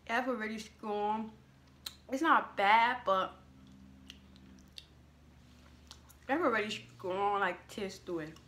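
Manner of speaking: slight mouth full; eating between words; talking while chewing. Real, not acted. Seen 1: Holds snack piece throughout; puts it to mouth at 5.4s, then talks.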